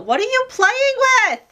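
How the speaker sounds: very high-pitched question to a cat; calling to pet. Real, not acted. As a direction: pitch it way up, scolding a pet playfully. Calls out to her cat.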